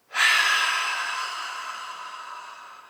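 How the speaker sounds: long, deep, audible sigh out through the mouth; teacher demonstrating an exaggerated relaxing sigh. Shown as a demonstration. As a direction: sigh it all out, letting go. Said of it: "And with a sigh".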